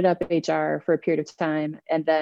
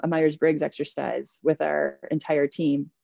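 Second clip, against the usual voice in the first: ordinary delivery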